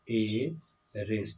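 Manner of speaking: एरेस split slowly, ए held long; pronunciation demo. Shown as a demonstration. Teacher reading a new word for pupils, slow pronunciation demo. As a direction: softly stretch एरेस into ए and रेस. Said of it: "इसको हम लोग पढ़ने वाले हैं एरेस".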